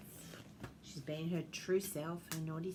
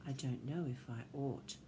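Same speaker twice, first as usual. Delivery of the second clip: low, soft pondering; doubtful musing to oneself; thinking aloud; 8 semitones lower, 5 dB quieter than usual. A narrator's reading. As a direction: ponder it quietly, lower-voiced. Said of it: "she stopped and she thought".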